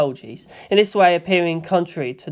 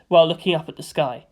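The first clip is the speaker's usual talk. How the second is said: ordinary delivery, nothing marked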